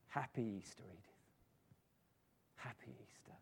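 hushed, low, tender goodbye; tearful farewell. A narrator's reading. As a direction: say it very softly through held-back tears. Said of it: "With tears in her eyes".

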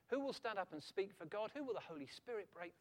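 ordinary talk

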